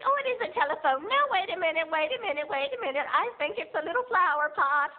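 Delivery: grandma voice. Retold or imitated. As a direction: coo like a sweet confused grandma. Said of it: "she'd be like".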